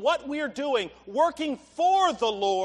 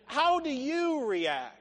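normal talking voice

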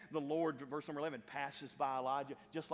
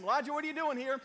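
loud, high, pressing question; God demanding; 9 semitones higher, 8 dB louder, faster than usual. Acted. God confronting hiding Elijah, loud and pressing. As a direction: call him out firmly. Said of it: "God asked him".

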